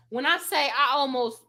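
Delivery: ordinary talk, nothing marked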